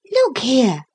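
sudden, high call for attention. A narrator's reading. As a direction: break in suddenly as a girl. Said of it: "said Lucy suddenly".

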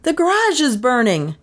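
high, urgent call from afar; ringing shout. A narrator's reading. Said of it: "rang out in a shout".

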